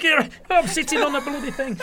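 half-laughing horror at the sofa; laughing in disbelief. Retold or imitated. Presenter realizes, laughing.

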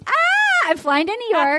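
excited squeal. Real, not acted. Excited about TV appearance.

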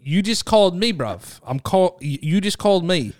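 insistent put-on British accent. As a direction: accuse in casual British slang and accent. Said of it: "you have to do British accent".

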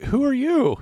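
polite high question; overly polite high-pitched greeting; politely. Retold or imitated. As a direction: ask "Who are you?" up high and sweetly. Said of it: "kind of politely".

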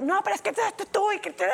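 high-pitched sputtered protest; protesting boyfriend. Retold or imitated. Boyfriend objecting to being dumped, mocking high sputter. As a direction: mimic a boyfriend scrambling for arguments. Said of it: "Y el otro".

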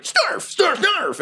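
very high squeaky goblin noises; goblin snarf. Acted. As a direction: squeak it like an excited little goblin. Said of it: "So Goblin Junior is like".

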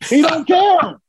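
high, punchy exclamation; emphatic amused exclamation. Real, not acted. Fan marvelling at a wrestler who plays three characters, emphatic.

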